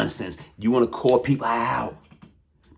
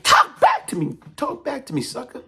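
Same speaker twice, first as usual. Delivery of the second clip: taunting call-out; 11 semitones higher than usual. Real, not acted. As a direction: challenge him to answer, voice up high. Calling out rival to respond.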